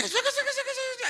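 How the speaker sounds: high squeaky mock nag; hammed-up nagging wife voice. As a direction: mimic the wife's nagging chatter with a laugh.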